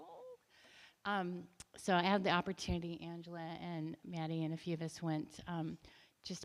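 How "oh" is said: high, soft breathy gasp of wonder; a small amazed oh as if seeing the mural; mock awestruck oh; awed oh. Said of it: "I go".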